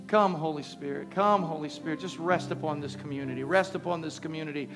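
loud, rising fervent prayer. Real, not acted. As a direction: pray it out, building in intensity. Loud prayer over music.

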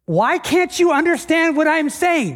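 high, slow exasperated plea; acted exasperation, slow and emphatic; frustrated Jesus. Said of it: "I think this is frustrated Jesus".

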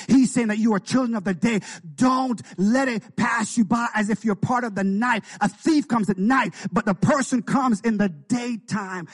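normal talking voice

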